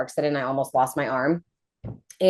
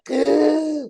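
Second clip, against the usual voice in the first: higher, startled intake of breath; sharp gasp; 12 semitones higher than usual. Retold or imitated. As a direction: gasp in alarm. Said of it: "And I was like".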